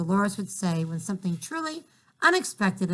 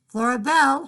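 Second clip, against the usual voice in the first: loud high call up the stairs; mother shouting; 6 semitones higher, 7 dB louder than usual. Acted. Mother calling her daughter from downstairs, loud shout. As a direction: shout her full name upstairs. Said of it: "her mother shouted".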